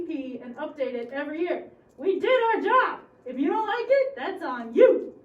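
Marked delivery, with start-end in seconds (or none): 2.0-5.1 sharp, louder and higher defiance; defiant. Acted. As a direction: throw it back at them. School official snaps back at parents.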